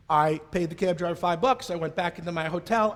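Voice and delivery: ordinary talk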